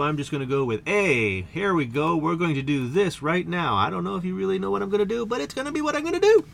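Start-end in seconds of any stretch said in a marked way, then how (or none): 3.7-6.4 fast, pitch climbing steadily to a high finish; rising, hurried wind-up; building hype. Real, not acted. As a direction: rush upward into a high sustained ending. Hyping up an unplanned start.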